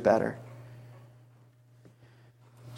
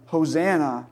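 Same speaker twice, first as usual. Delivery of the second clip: usual speaking voice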